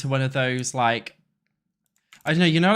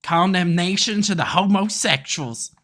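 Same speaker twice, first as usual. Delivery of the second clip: loud, hammed-up American Southern twang; teasing US Southern accent; mock Southern accent; 5 semitones higher, 6 dB louder than usual. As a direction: play a Southerner greeting a bar, louder. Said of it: "I'm gonna say".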